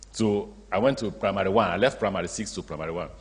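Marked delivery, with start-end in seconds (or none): none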